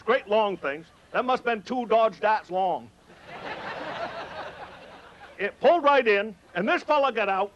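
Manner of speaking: ordinary talk, nothing marked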